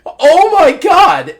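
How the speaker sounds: loud, high, drawn-out exclamation; hammed-up, slow shocked outburst; acted shock. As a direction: cry out in exaggerated horror. Retold shock at doctor's bad news.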